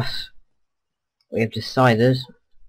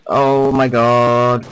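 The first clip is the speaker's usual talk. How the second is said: drawn-out gasp; 8 dB louder, much slower than usual. Real, not acted. After a scary close call.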